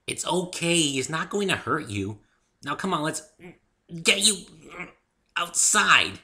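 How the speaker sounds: bubbly pony coaxing a shy friend out; coaxing Pinkie voice. A narrator's reading. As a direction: sweet-talk a shy friend out.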